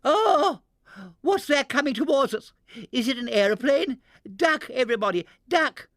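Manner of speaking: high, loud panicked shriek; screaming in alarm. A narrator's reading. Missus Teddington warning everyone of the giant bird, panicked shriek. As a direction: shriek a warning at the oncoming danger. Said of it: "Missus Teddington gave a scream".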